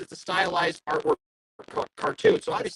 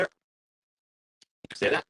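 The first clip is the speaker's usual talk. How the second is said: ordinary talk, nothing marked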